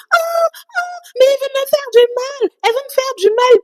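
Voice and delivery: cri très aigu et tenu, façon victime qui hurle; cris imités. Retold or imitated. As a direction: imite une femme qui se met à crier au secours. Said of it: "Crier genre". (hurlements perçants rejoués, un peu plus forts)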